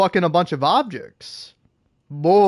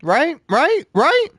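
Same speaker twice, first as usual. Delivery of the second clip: insistent; 11 semitones higher, slower than usual. Real, not acted. Insistent repeated rhetorical question.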